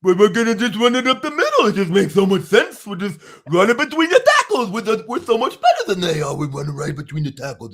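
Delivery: high, loud dopey cartoon bellow; caveman voice. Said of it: "do the caveman voice for Tommy Rees play calling".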